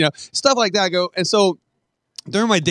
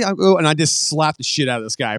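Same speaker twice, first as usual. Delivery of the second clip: ordinary talk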